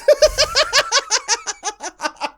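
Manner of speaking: high burst of laughter. Real, not acted. Laughs at the odd sentence.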